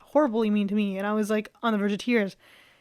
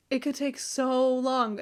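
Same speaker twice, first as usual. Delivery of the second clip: usual speaking voice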